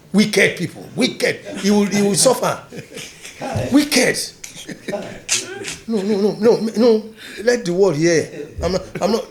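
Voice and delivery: loud, high, outraged denouncing with laughter; furious outcry. Real, not acted. Telling the listener about the people who cheated him, in loud outraged cries.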